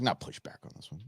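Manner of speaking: unvoiced soft self-correction; whispered aside. Real, not acted. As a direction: drop to a whisper mid-sentence.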